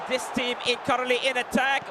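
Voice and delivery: usual speaking voice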